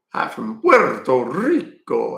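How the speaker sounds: put-on Puerto Rican accent. Streamer greeting a Puerto Rico viewer, showy Puerto Rican accent.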